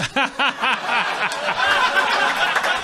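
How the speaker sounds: loud high burst of laughter; big real laugh. Real, not acted. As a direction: crack up hard at your own story. Bursts out laughing at own story.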